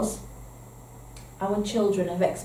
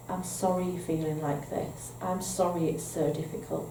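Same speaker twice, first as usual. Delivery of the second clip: put-on soft, gentle sorry to the child; calm reassurance; 2 semitones lower, 7 dB quieter than usual. Shown as a demonstration. Adult calmly soothing an upset child, much softer. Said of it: "If we respond to children by saying, we stay calm".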